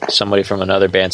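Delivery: usual speaking voice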